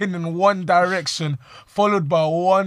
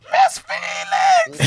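high, loud, drawn-out scream for the teacher; imitated scream; 5 dB louder, much slower than usual. Ali screaming for the teacher in panic, high and loud. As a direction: shriek the name like a panicking kid. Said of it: "he started screaming".

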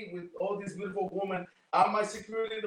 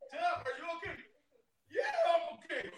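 laughing; 7 semitones higher, 3 dB quieter than usual. Retold or imitated. Retelling the fight, laughing.

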